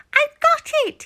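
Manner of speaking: high, loud eureka in mouse voice; excited squeak. Acted. The mouse jumps from bed with an idea, high excited squeal. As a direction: burst out with the idea.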